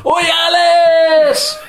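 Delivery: long high sing-song shout of Oi, Alice; crowd jeer. Retold or imitated. Fans in the old stand jeering the reporter, sung shout. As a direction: chant it like a terrace crowd, slow and loud. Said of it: "and everybody goes".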